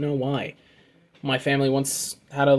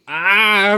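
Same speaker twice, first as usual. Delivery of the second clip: short sharp scream, louder and higher; mock scream; 5 semitones higher, 6 dB louder than usual. A narrator's reading. Reading the comic character going outside to scream, short loud shout. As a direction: belt out one quick cartoon scream. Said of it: "She wanted to go outside and scream".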